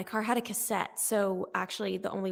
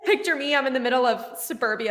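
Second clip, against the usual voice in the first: ordinary talk, nothing marked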